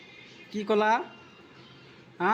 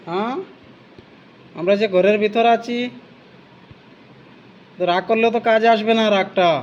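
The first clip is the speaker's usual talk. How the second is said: louder, talking across to an angry animal; calling out loudly; 6 dB louder than usual. Real, not acted. Visitor loudly soothing an angry monkey through the bars. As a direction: raise the voice to reach the monkey outside.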